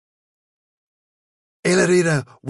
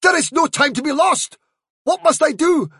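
high, urgent plea; the frightened dog begging the fairy what to do; anxious pleading dog; 10 semitones higher than usual. A narrator's reading. Dog begs fairy for help.

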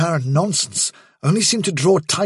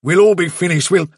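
louder, urgent protest; Bigwig's voice, cut off; 4 semitones higher, 5 dB louder than usual. A narrator's reading. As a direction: blurt out the warning before being interrupted.

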